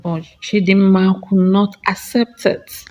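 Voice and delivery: ordinary delivery, nothing marked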